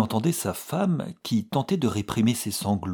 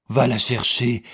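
breathless; 3 semitones higher, 7 dB louder than usual. A narrator's reading. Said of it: "dit-elle haletante".

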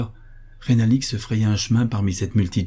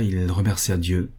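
plain reading voice

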